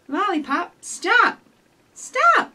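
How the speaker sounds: put-on a mother calling her child to halt; mama shouting. Mother calls stop in story.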